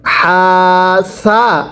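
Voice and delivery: হা, সা। with সা said softly; হা, সা। easing gently into সা. Shown as a demonstration. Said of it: "সাটা নরম করে আবারও পড়বেন". (সা নরম করে)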